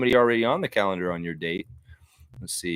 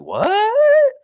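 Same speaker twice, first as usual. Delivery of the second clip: high, loud startled "What?"; shocked what; 9 dB louder than usual. Retold or imitated. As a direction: react as if floored by the news. Shocked reply to dad.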